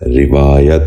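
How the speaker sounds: روایت said "rivaayat", short "i" after the r; correct pronunciation. Shown as a demonstration. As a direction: open روایت with "ri", then long "vaa". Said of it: "دوبارہ سنیے".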